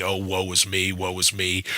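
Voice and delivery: hammed-up woe-is-me snivel; mock whining. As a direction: whimper it like someone sulking in the corner. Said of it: "sniveling and whining and complaining".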